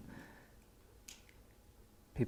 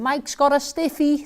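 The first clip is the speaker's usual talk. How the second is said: high, loud, drawn-out yell; shrill-pitched mocking shout of a kid; screaming schoolboy; 13 semitones higher, 5 dB louder, slower than usual. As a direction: yell it across the bus to your mates. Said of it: "No ginger run screaming".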